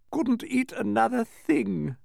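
puffed out, high and slow; stuffed duke puffing after a feast; overfull puffing. A narrator's reading. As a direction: drag it out, high, as if too full. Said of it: "puffed the Duke".

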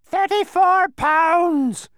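very high, loud, drawn-out screech; piercing bird shriek. A narrator's reading. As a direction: shriek it slowly like a departing bird. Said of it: "shrieked the bird".

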